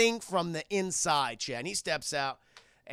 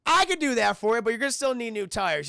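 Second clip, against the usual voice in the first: loud, higher saleswoman voice; saleswoman haggling; 5 semitones higher, 6 dB louder than usual. Retold or imitated. Used car lot lady answering an offer, loud haggling. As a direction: pitch it up and project the counteroffer.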